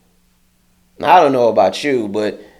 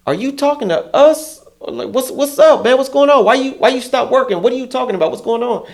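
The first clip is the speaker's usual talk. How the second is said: high mock confrontation; 11 semitones higher than usual. Confronting the coworker.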